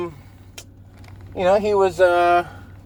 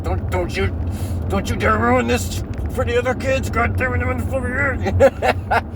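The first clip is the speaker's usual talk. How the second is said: hissed angry parent, teeth clenched; through gritted teeth; 6 semitones higher than usual. Retold or imitated. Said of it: "You're talking through your teeth".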